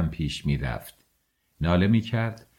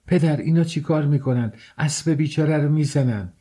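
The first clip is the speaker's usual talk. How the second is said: higher-pitched, mildly cried-out protest; child's cry; 6 semitones higher than usual. A narrator's reading. Boy appealing to his father over the beaten horse, crying out. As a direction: plead upward as a child, without full shouting. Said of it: "فریاد کرد".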